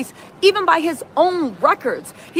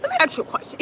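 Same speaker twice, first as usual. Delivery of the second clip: high, mocking take on the lawyer's line; a hint of a smug lawyer, pitched up; imitating lawyer; 12 semitones higher, 4 dB quieter, much faster than usual. Host mocks the defense lawyer's showy opener, high voice. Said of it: "He said".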